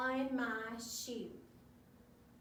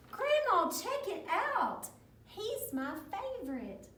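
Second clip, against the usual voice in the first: high-voiced storybook child; hammed-up eager kid, easing off at the end; excited grandchild; 8 semitones higher than usual. A narrator's reading. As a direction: call out to grandma in delight.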